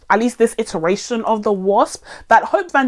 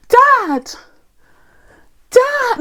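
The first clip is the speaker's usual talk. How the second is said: high-pitched mocking cry for Dad; mock scream; 3 dB louder than usual. Reviewer mocking the actress's scream for her dad, mock scream. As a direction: imitate an overacted movie scream. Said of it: "where she would scream".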